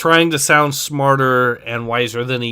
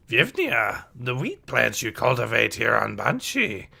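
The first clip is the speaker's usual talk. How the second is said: put-on wise old teacher with swooping pitch; Yoda's voice; 2 semitones lower, 5 dB quieter than usual. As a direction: question the pupils as the old sage.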